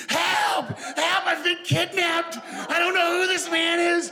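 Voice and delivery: high mock panicked shout of help; wife in a confused panic yells at her husband from the car, screaming; mom screaming for help. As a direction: shriek it like a woman who thinks she's kidnapped. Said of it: "she starts screaming".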